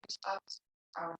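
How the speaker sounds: soft, unhurried meditation voice with long pauses; guided meditation. Real, not acted. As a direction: guide them gently into stillness, leaving long silences. Leads a seated meditation.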